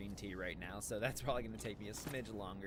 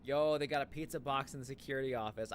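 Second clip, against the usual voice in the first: excited; 4 semitones higher, 7 dB louder than usual. Real, not acted. Spots pizza box in game.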